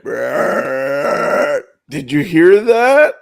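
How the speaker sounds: loud, slow, proud after the belch; booming show-off question; loud slow boast. Retold or imitated. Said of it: "and he's like".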